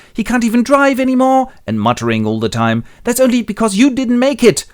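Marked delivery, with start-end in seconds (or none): 0.1-1.5 high-pitched, loud grumble; acted son's complaint. Happy complains to his brother about Willie, exasperated. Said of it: "and Happy goes". 3.0-4.7 high, loud retort; acted accusation. Brother answers brother in an overheard argument, accusing.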